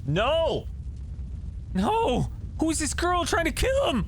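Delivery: high desperate no; alarmed cry. Real, not acted. Player seeing the kid turn toward the flames, alarmed cry.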